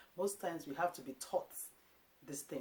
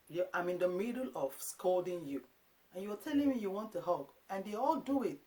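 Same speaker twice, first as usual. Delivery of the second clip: normal talking voice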